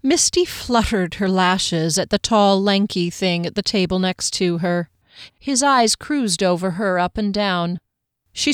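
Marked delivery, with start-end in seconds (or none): none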